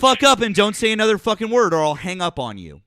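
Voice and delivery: loud fast furious threat; angry yelling. Real, not acted. Heated argument, yelling at caller.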